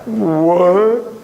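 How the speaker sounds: drawn-out, rising "what?"; acted-out disbelief from a baffled class; stunned reaction. Class reacts to spirit claim.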